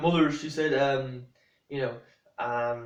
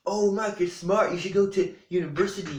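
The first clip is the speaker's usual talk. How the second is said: higher-pitched imitation of mum; mock encouragement in a raised pitch; mock gushing mother; 5 semitones higher than usual. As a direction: parody a mother's overblown praise. Mimicking his mother's flattering advice.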